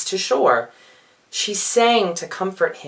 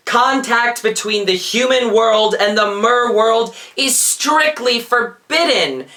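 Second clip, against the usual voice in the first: higher, louder booming command; thundering king; 4 semitones higher, 4 dB louder than usual. Acted. King Triton forbidding his daughter contact with humans, thundering. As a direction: thunder the law down at her. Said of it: "he thundered".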